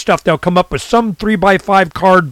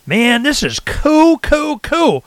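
excited; 9 semitones higher, much slower than usual. Retold or imitated. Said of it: "I'm going".

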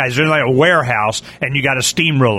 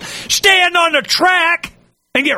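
high, slow, stretched-out mock call; mock cry; 13 semitones higher, slower than usual. Warning people off the railroad track, high drawn-out mock cry. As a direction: draw it out in a high mock wail.

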